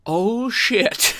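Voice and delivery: sudden high, loud alarmed curse; high startled swear; shocked oh shit. Retold or imitated. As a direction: blurt it out in shock. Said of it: "I'm like".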